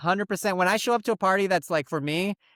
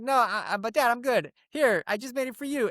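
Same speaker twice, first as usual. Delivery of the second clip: put-on high, protesting kid; pleading refusal; 8 semitones higher than usual. Kid turning down dad's drink at the table, high protest. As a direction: imitate a kid trying to get out of it.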